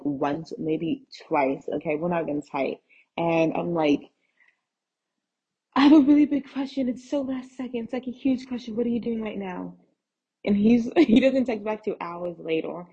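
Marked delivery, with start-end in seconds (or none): 5.7-9.7 high-pitched, hurried, pleading opener; sheepish favour-asking. Retold or imitated. Texting a guy she barely knows, sheepishly pleading.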